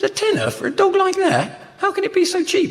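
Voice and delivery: very high-pitched disbelieving squeal; hammed-up astonishment, pitch shooting right up; incredulous. Customer questions the shopkeeper's cheap price, high-pitched hammed-up incredulity.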